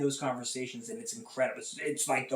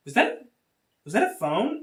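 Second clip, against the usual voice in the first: higher, louder, startled question; surprised instructor; 8 semitones higher, 6 dB louder than usual. Retold or imitated. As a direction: catch the sound mid-lecture, startled. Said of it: "but she was like".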